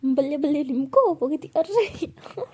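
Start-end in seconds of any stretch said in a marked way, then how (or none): 0.0-1.1 louder, teasing jingle-like question; mocking loud jingle. Pihu mocking Bhavna's word with a loud jingle. 1.5-2.5 much higher, squeaky whine; playful whine. Acted. Bhavna whining playfully when teased.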